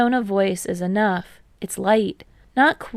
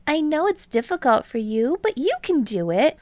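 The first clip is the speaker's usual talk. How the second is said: lightly higher mock encouragement; teasing Hinata; 7 semitones higher than usual. A narrator's reading. Hinata playfully coaxing Kei, lightly higher and teasing. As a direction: egg him on playfully.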